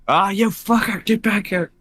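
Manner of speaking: high, exasperated shout after it; frustrated yell. Real, not acted. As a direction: yell after the fleeing monster. Mimic escapes into ship.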